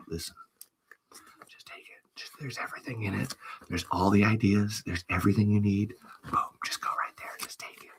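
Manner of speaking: hushed, conspiratorial hand-off; secretive whisper. Pretend. Whispering to the successor while handing over the notebook. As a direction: press the notebook on them in a whisper.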